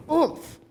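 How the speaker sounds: "oomph" drawn out with force. Shown as a demonstration. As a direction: put weight behind the word "oomph". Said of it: "you say it with".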